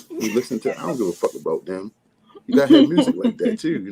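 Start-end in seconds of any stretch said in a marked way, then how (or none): 2.5-3.8 much higher and louder, fired up; hyped up. Real, not acted. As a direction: shout the point with full energy. Hyped point about music for haters.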